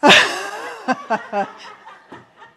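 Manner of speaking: loud burst of laughter; big amused laugh; hearty laugh. Real, not acted. Laughs with the audience.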